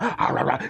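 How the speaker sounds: short burst of barks; imitated dog barking. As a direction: bark a few times like a dog. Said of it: "He just barked at me".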